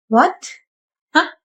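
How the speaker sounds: ordinary delivery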